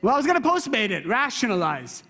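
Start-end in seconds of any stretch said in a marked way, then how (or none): 0.0-1.1 higher, quick mock excuse; defensive. Husband excusing himself to his wife, defensive. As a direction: protest your innocence in a rush. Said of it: "To be defensive, how?".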